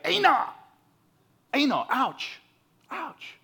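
mock cry of pain as a splinter is probed; mock pained yelp. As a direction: yelp like something stings. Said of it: "we say in South Africa".

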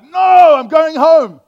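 put-on boy shouting he's going home; loud protest. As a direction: yell it out in panic, high and loud. Frightened boy refusing to stay.

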